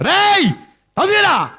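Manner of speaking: bang on the door and yell his name. Acted. Farmer calls thief at door.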